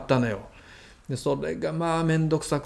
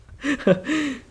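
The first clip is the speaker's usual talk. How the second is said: laugh; 11 semitones higher than usual. Real, not acted. Host laughs at brother story.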